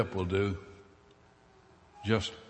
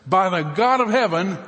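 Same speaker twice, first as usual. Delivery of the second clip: swearing an oath; 8 semitones higher, 7 dB louder than usual. Acted. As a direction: vow it loud and high. Peter's oath repeated.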